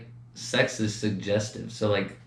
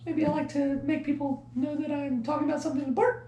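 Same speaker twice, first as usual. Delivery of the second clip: very high, softer squeak; high-pitched voice; 5 dB quieter than usual. Shown as a demonstration. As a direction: pitch way up like it matters. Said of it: "So I make my voice go a little high".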